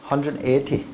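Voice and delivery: put-on a light, quick Indian accent on the number. Answering the points question in a light Indian accent. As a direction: slip a touch of Indian accent into the points total. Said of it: "Was that an Indian accent?".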